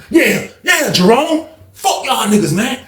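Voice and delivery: high, shouted jeer at Jerome; mock taunting shout. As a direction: yell it over the crowd to provoke him. Taunting Jerome mid-fight, shouting.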